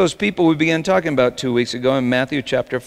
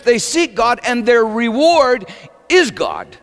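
higher, louder, rising sermon voice; preaching; 11 semitones higher, 5 dB louder than usual. Acted. Pastor building to a climax for his congregation, fervent preaching. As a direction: build it up like a preacher in full flight.

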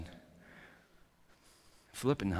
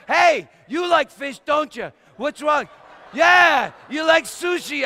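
louder and higher, a jolly host calling out to his friends; laughing Jesus; 6 semitones higher, 7 dB louder than usual. Retold or imitated. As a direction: hand out fish with booming glee. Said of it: "He was laughing and laughing".

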